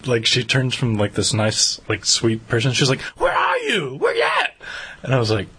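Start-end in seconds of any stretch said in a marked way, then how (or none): 3.2-4.5 high shouted demand; mock yell. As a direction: yell it at the guy on the phone. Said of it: "she's, like, yelling at this guy".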